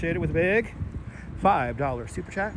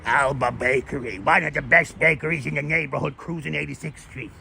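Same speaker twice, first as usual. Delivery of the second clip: a lightly put-on Brooklyn tough-guy voice, a bit lower; hint of a Bay Ridge wiseguy plugging local spots; William DeMeo impression; 3 semitones lower than usual. Streamer imitating an actor for his chat, lightly put-on Brooklyn voice. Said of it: "William DeMeo kinda talks like this".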